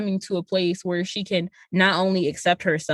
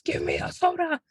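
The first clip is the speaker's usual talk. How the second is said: mock villain demand; 8 semitones higher, 4 dB quieter than usual. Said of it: "he was like".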